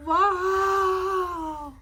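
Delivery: loud, high wow of relief; excited shout. Real, not acted. Kid shouting with relief after a hard pull, high and loud. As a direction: let the joy burst out. Block pulled out successfully.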